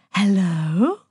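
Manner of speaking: drawn-out, breathed hello; breathed phone greeting. A narrator's reading. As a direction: answer the phone with an airy, lilting question. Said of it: "Aphrodite breathed down the line".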